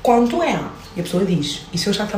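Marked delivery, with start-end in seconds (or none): 0.0-0.6 "Quanto é?" in a mock Rio accent, pitched higher; carioca accent. Shown as a demonstration. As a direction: imitate how people in Rio ask. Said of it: "as pessoas no Rio de Janeiro falam assim".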